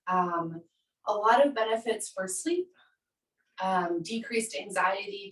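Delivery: usual speaking voice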